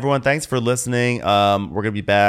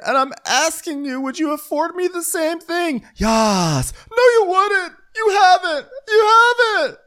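high-pitched hammed-up argument; mock shrieking; 5 dB louder than usual. Host mimics a housewife accusing her friend, mock shriek. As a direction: screech it like a reality-show row. Acted quote from a quarrel.

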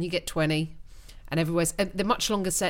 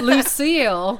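loud, higher mock Southern; drawled Southern name-calling; fancy Southern accent; 5 semitones higher, 8 dB louder, slower than usual. Englishman plays along with guest, hammy Southern. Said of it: "I like the fancy".

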